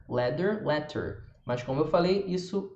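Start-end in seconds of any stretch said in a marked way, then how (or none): 0.1-1.2 "leather" with a clear d, "letter" with a crisp t; marked d and t. Shown as a demonstration. Teacher showing the slower, marked version. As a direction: mark the consonants fully in each word. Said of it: "marcar mais um pouquinho as letras D e T".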